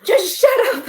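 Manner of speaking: high, loud retold outburst; wife telling her nagging husband to shut up, loud; telling him to shut up. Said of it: "I said".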